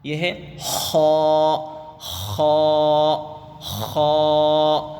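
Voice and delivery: teacher chanting a rough خا; rasping letter. Shown as a demonstration. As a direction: scrape خا from the throat. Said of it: "کھرکھری آواز".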